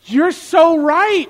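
high, loud, drawn-out mock surrender; over-the-top imagined wife giving in; wife conceding, imagined. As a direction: gush the admission, exaggerated and slow. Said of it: "she'll be like".

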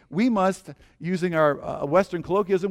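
normal talking voice

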